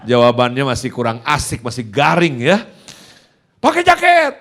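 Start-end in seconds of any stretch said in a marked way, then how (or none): 3.6-4.3 high, sharp mock yell; snappish father. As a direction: imitate a dad's curt, high-pitched order. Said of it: "Dia kalau ngomong nyolot begitu".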